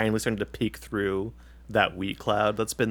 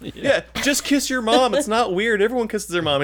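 mock heckler; 10 semitones higher than usual. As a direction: egg him on from the crowd. Crowd member egging him on.

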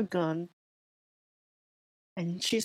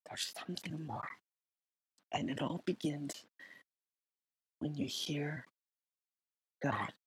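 breathy whispered ending, much softer and lower; 3 semitones lower, 10 dB quieter than usual. Acted. Podcast host whispering his closing message to listeners. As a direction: lean in and whisper the final thought.

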